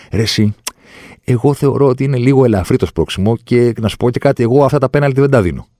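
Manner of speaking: mock-casual imitation of the VAR. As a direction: mimic a know-it-all official brushing it off. Mocking a VAR referee's reasoning.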